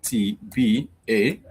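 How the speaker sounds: K T B A spelled out very slowly, letter by letter; K T B A said slowly, each letter held apart. Shown as a demonstration. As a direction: spell it out slowly as the wrong example. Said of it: "So you cannot have, like".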